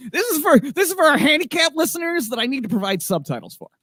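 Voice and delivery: high-pitched put-on voice, settling toward the end; mock announcement. Host jokes to listeners needing subtitles, mock high-pitched voice. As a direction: pipe it up high in a silly voice. Joking aside in put-on raised voice.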